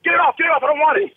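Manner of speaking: high, hurried mock panic; hammed-up panic to get rid of it; phobic panic. Acting out dumping the title.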